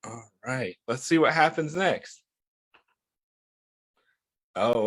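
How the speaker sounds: normal talking voice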